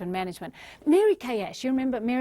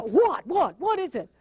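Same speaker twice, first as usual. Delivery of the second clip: impatient; 4 semitones higher than usual. Retold or imitated. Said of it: "They just see the".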